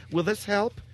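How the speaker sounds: high, timid mock question; mocking Mike. Host mocks his producer offering tissue paper, high and timid. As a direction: mimic a clueless helper holding up tissue. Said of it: "Mike comes back with a piece of tissue paper".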